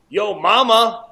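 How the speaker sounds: loud, high imitated comeback; retold taunt. Six-year-old insulting the other kid, imitated loud taunt. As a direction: belt out the punchline as a cheeky kid.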